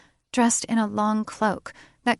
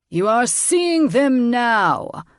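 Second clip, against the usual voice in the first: high, loud, slow put-down; triumphant retort; 5 semitones higher, 6 dB louder, slower than usual. A narrator's reading. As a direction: shut the king up.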